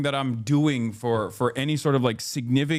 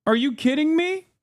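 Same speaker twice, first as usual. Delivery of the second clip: mock outraged retort. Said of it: "It's like".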